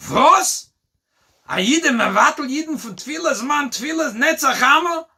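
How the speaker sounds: put-on high, loud indignant outburst in Yiddish-accented voice; outraged rabbi. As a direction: cry out in scandalized disbelief. Rabbi outraged at missed prayers.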